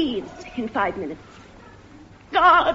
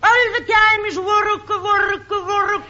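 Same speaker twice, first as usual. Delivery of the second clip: put-on Italian accent; 5 semitones higher than usual. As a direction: berate him in a hammy Italian accent. Wife scolds husband, Italian accent.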